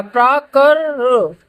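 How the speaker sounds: प्रा कर र drawn out slowly; slow sounding out. Shown as a demonstration. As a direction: break the word into slow sounds. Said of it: "पहले कै का sound आता है". (धीरे-धीरे टुकड़ों में)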